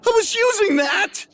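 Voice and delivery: very high indignant squeak; high squeaky complaint. Acted. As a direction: whine it in a tiny voice. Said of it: "you yell at the rude wall".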